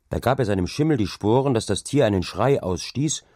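plain reading voice

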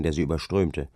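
ordinary delivery, nothing marked

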